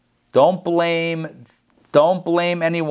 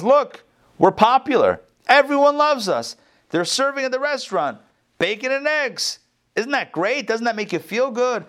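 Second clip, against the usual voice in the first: much higher, eager chicken voice; excited chicken; 11 semitones higher than usual. Acted. The chicken boasts to the pig about the menu, high and eager. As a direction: chirp it up like a proud, thrilled chicken.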